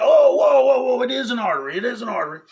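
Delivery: high, loud, startled whoa, settling at the end; alarmed shout. Retold or imitated. Doctor sees an artery squirting blood, startled loud shout. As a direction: shout it as blood sprays at you.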